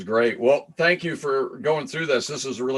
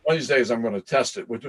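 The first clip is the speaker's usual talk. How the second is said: usual speaking voice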